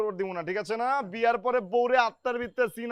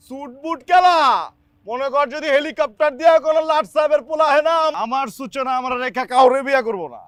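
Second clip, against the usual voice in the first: higher, louder angry boasting; loud rant; 4 semitones higher, 8 dB louder than usual. Acted. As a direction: bellow it at the lackeys. Ranting loudly about the bride. (উঁচু গলায় রাগী চিৎকার)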